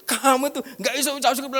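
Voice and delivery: high, loud nagging wife voice. Retold or imitated. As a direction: play the wife pestering him about his silence. Wife scolds him. (istri yang mengomel dengan suara tinggi dan keras)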